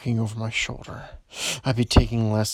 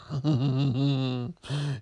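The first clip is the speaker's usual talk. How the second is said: sinister chuckle. Acted. Villain laugh.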